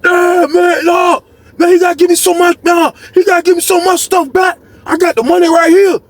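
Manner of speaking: high-pitched shouting about the cookies; shouted cellmate impression. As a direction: yell like the angry cellmate. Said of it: "You like".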